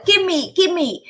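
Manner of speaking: put-on high, demanding baby shriek; a tantruming baby shrieking for it; shrieking child. Boy shrieking at his grandfather, high and demanding. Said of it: "started shrieking at his grandfather".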